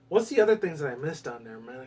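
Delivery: ordinary delivery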